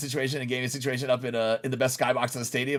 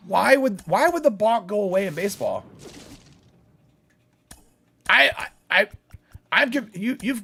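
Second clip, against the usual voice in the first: baffled; 7 semitones higher than usual. Real, not acted. Streamer baffled by balk opinion.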